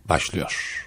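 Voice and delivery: low, solemn announcer voice; deep, hushed presenter voice; deep pitch shifted. Pretend. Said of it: "Niye böyle tebareki gibi bir ses tonu var?".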